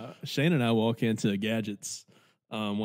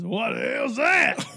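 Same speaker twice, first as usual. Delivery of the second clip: high, slow mock shout; incredulous barfly; 10 semitones higher, slower than usual. Barfly questioning a stranger's drink, incredulous mock shout. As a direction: blurt it out like a baffled drinker.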